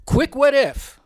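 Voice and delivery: usual speaking voice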